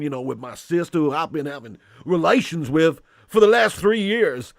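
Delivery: put-on Southern accent from Alabama; mock Alabama accent. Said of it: "Do Alabama's".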